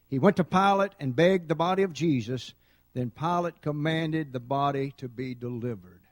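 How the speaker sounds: loud, measured reading of the verse; preacher reading Matthew aloud to the church, loud and deliberate; scripture reading. Acted. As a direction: proclaim the passage to the congregation.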